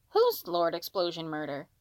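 usual speaking voice

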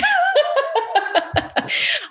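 bright high burst of laughter. Real, not acted. Laughs at own excitement.

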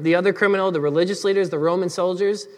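usual speaking voice

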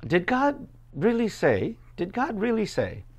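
high, slow, insinuating serpent voice; sly serpent. Retold or imitated. Preacher voicing the serpent.

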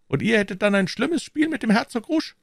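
high-pitched musketeer's retort; high, unhurried comeback; retorting Aramis. A narrator's reading. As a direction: answer back in a high voice.

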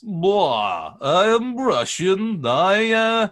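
loud, high mock Russian accent; exaggerated Russian accent parody; Anya Taylor-Joy Russian accent. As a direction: ham up a Russian accent, drawn out and pitched high. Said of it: "noticeable as Anya Taylor-Joy's".

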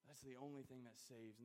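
ordinary delivery

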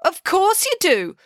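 high, drawn-out accusation; accusing. A narrator's reading. Matilda turns on April, accusing. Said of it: "accused Matilda".

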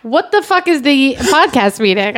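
ordinary delivery

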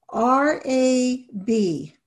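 "R A B" with a slow pause between letters; slow letter names. Shown as a demonstration. Teacher modelling spelling for students, slow letters. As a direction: spell each letter slowly and clearly. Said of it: "you want them saying the letter names".

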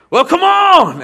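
high, loud, urgent call; fired-up holler; excited shout. Retold or imitated. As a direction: yell him out the door to face the storm. Preacher urging him outside.

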